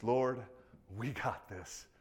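soft, low, calm; humble confidence. Retold or imitated. Preacher contrasts Peter's boast with humble trust, soft and calm. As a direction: say it quietly, leaning on God. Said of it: "confidence is".

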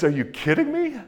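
high, incredulous exclamation; amused disbelief. Retold or imitated. Alexander laughing off the courier's fear, high incredulous exclamation. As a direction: toss it off, amazed anyone would think so. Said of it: "And he says".

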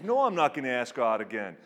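put-on loud, quick refusal in a Boston accent; rapid-fire mock Boston accent. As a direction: imitate a brash Boston accent. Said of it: "he's got this thick Boston accent".

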